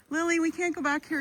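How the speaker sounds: calling the dog loudly. Real, not acted. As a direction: shout across to the dog.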